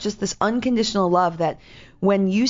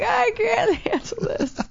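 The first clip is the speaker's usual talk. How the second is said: high, slow, mock-overwhelmed wail; slower than usual. At the ashram, giving up on herself: high slow wail. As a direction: squeak it out like you're falling apart, slowly. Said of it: "I'm like".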